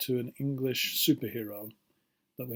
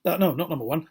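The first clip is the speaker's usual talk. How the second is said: ordinary talk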